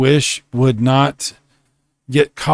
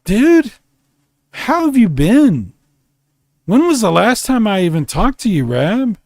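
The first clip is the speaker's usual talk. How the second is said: excited greeting; 7 semitones higher than usual. Real, not acted. Delighted reunion.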